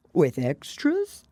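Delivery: plain reading voice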